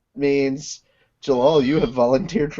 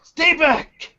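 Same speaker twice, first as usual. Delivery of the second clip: urgent warning; 13 semitones higher than usual. Acted. Acted warning.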